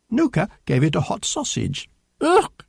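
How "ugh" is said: high, loud "ugh"; short high yelp of disgust; disgusted cry. A narrator's reading. Mouse given a hot sausage, crying out. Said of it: "it cried".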